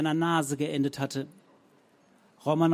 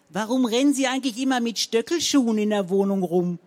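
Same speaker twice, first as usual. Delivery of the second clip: higher, louder accusing question; 6 semitones higher, 6 dB louder than usual. A narrator's reading. Frau Zenker interrogates Rabenhorst about her heels, loud. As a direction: demand it like an interrogation.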